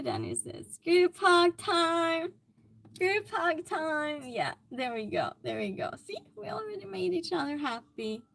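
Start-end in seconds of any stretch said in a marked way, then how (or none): 0.8-4.2 loud, drawn-out cheer; rallying call. Pretend. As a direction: call it out slow and high, stretching each word. Cheering a chat message on.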